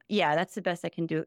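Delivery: ordinary delivery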